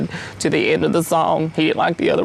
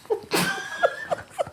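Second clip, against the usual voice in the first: a sudden, much higher, soft giggle; high breathy laugh; 8 dB quieter than usual. Real, not acted. Guest laughing softly at his own Funyuns story. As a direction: let a quiet laugh slip out. Awkward pause ends the interview.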